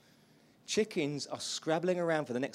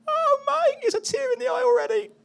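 much higher, louder, voice breaking with tears; tearful; 12 dB louder than usual. Real, not acted. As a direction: choke up as you say it. Moved retelling the worship video.

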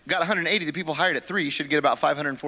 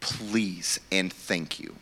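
lower, softer, slow mock meekness; meek workers; 9 semitones lower, 6 dB quieter, much slower than usual. Master recalling workers who accepted, slow and meek. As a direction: sheepishly accept the offer. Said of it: "all of you were like".

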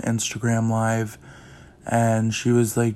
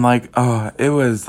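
ordinary talk, nothing marked

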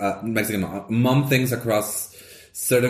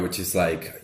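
ordinary talk

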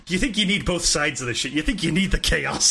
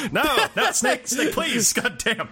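higher-pitched exasperated protest; mock pleading; 6 semitones higher, slower than usual. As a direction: beg in comic exasperation, pitched up. Mock pleading in cigarette bit.

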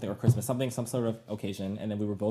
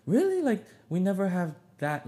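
much higher, startled disbelief; incredulous dad; 9 semitones higher, 4 dB louder, slower than usual. Retold or imitated. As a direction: play the dad who can't believe it. Dad reacting, drunk, disappointed.

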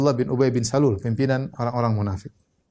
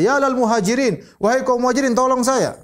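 calling for help; 9 semitones higher than usual. Retold or imitated. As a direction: shout to your clan to come help. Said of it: "Maka yang Muhajirin berkata".

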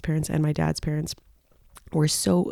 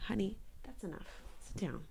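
much softer aside to a pet; quiet scolding; 3 semitones higher, 19 dB quieter than usual. Real, not acted. Excuse me, owner to her attacking dog, hushed scolding. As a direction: tell the dog off away from the mic.

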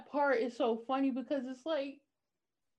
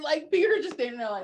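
usual speaking voice

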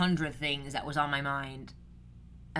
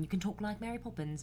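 softer, higher, a hint of British; British accent; 4 semitones higher, 8 dB quieter than usual. Podcaster illustrating a familiar accent, light British accent. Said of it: "I don't even think she's British. Why did I even do that?".

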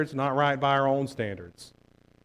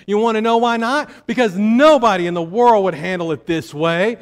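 mocking tempter, louder and higher; 5 semitones higher, 5 dB louder than usual. Preacher voicing the tempter's mocking whisper, rising, louder. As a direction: sneer it like the voice of temptation. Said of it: "another voice".

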